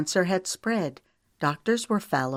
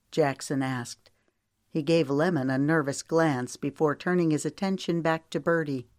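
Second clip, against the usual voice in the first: ordinary delivery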